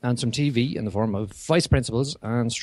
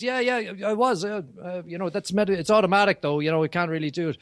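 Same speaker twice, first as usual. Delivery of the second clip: put-on Boston accent; 7 semitones higher than usual. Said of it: "I love the accent".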